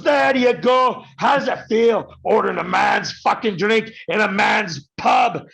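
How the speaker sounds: high, loud mocking bellow; taunting shout. Bartender jeering at the American: loud high taunt. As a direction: roar it at him like a gloating barman.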